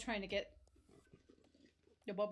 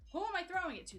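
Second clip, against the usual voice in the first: higher, exasperated repeat of "Who am I throwing it to?"; 8 semitones higher, faster than usual. Real, not acted. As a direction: ask again, pitched up in disbelief. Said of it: "Brother, who am I throwing it to?".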